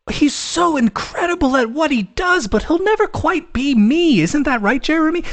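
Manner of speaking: high, lilting backhanded praise; sweet-sounding compliment with a sneer under it; smug managerial chef. Retold or imitated. Chef belittling Jeremy while praising another, high smug tone. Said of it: "that managerial vibe of".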